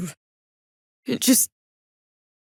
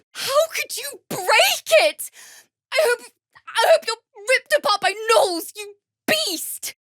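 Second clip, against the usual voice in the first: much higher, crying and shouting; tearful furious outburst; 14 semitones higher than usual. Acted. Bard screams through tears at the one who broke her lute.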